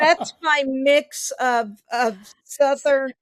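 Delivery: laughing while explaining. Real, not acted. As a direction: speak through laughter. Laughing about her accent.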